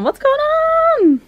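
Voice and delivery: very high, drawn-out squeaky question; excited onlooker. Retold or imitated. Voicing Chase wondering about the box, high squeal. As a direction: squeal it slowly as the curious one. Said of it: "He's all, he's like".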